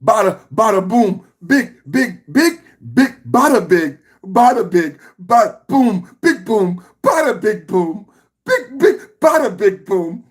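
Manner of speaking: high, loud chanted bada big boom.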